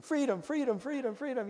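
high little chant of an escaping dog; dog's thoughts. Retold or imitated. Runaway dog thinking as it runs, lightly voiced chant. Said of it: "you know, thinking".

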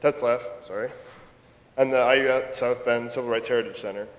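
usual speaking voice

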